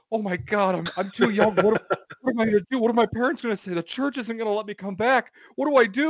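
put-on high, loud panicking teen with laughter; a hammed-up frightened teen losing it; panicked meltdown. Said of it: "He's just like".

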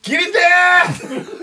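laughing wail. Real, not acted. Begging to switch off laughter.

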